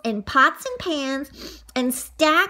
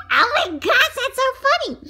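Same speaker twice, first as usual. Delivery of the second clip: usual speaking voice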